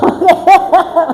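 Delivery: carcajada corta y aguda; risa aguda. Real, not acted. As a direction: se ríe de sí misma, alto. Risa en medio del testimonio. (risa aguda y breve)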